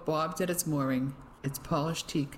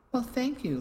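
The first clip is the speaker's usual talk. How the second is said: short, higher and curt; ex-husband curtly thanking the new husband, clipped; 8 semitones higher than usual. Acted. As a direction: bite the thanks off. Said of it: "Lionel replied in a clipped voice".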